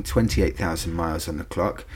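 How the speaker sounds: normal talking voice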